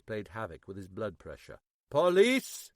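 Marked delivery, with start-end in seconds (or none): 1.9-2.7 calling out. A narrator's reading. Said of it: "he called again".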